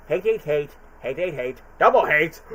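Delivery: lightly voiced Carrey Grinch, chanting hate; Jim Carrey Grinch impression. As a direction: spit out each hate like the Grinch. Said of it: "with my Carrey voice".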